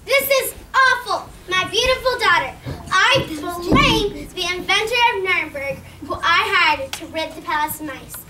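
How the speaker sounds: loud, furious royal voice; angry king. Acted. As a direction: rage at the inventor. The king rages at the inventor.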